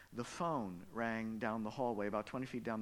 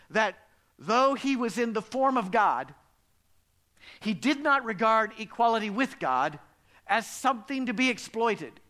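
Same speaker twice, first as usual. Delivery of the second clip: much louder, higher scripture proclamation; preaching at full force; 7 semitones higher, 11 dB louder than usual. Acted. Preacher reading Philippians to the church, booming. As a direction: proclaim the verse to the back pew.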